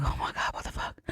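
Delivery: hushed, whispery disbelief; mock freak-out. Visitors reacting to the deaths, hushed mock freak-out. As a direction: freak out under your breath. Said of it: "they're all freaking out".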